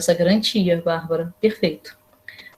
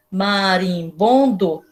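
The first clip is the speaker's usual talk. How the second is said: very slow, "Marimbondo" split into its syllables; "Marimbondo" stretched slowly, each syllable separate; much slower than usual. Shown as a demonstration. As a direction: sound out "Marimbondo" one mouth opening at a time. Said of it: "Quantas vezes a gente abre a boca pra falar marimbondo?".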